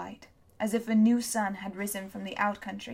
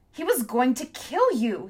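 protesting; 3 semitones higher, 4 dB louder than usual. A narrator's reading. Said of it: "he protested".

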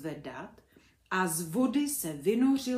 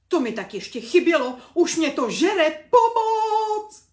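much louder and higher, ending in a sung wail for help; panicked cry; 9 semitones higher, 15 dB louder than usual. A narrator's reading. Drowning teacher screams for help in panic. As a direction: howl it like a man being eaten.

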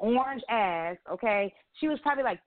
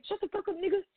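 imitating little boy; 10 semitones higher than usual. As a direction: mimic a mouthy kid in a much higher voice. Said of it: "Little ass boy was up there".

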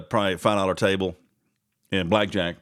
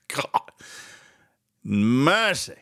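normal talking voice

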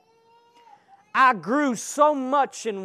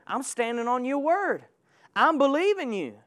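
ordinary talk

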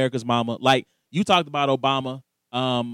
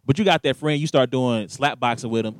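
ordinary talk, nothing marked

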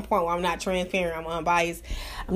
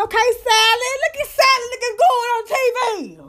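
much higher, loud cheering her on; much higher, louder, hype-girl praise; hyping Sally; 13 semitones higher, 4 dB louder than usual. Retold or imitated. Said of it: "I said".

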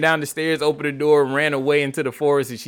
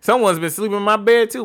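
higher mama bear; 8 semitones higher, 4 dB louder than usual. Acted.

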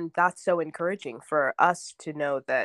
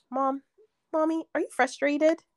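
put-on toddler voice; 9 semitones higher than usual. Toddler quoted by mother.

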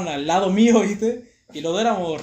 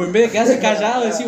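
ordinary talk

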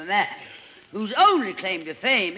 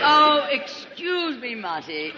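mock-grand excuse; 6 semitones higher, slower than usual. Mock-grand apology to Marty.

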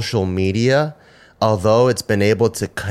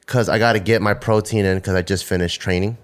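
usual speaking voice

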